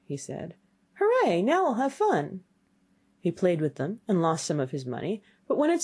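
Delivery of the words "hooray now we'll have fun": high, playful hooray; gleeful cheer. Acted. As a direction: cheer like an eager youth, pitched up. Youth cheers at starting the game.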